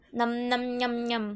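low, steady nom-nom noises; low chanted munching sounds; pretend munching. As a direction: mime eating with your voice. Pretends to eat golden apple.

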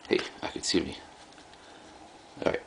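normal talking voice

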